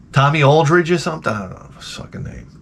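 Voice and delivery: ordinary talk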